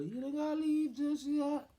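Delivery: loud, very high, drawn-out mock mom voice; girlfriend's mom. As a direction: call out slowly in an exaggerated falsetto. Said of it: "The mother".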